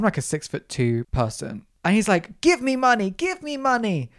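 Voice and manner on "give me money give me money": high, whiny mock demand; imitated screaming child. Child demanding money from a passer-by, high mock yelling. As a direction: mimic a pestering kid, pitched well up. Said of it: "five-foot child screaming at me".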